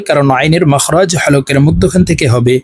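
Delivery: ordinary delivery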